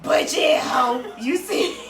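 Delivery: high, laughing mock taunt; sassy laughing shout. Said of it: "So I'ma just say".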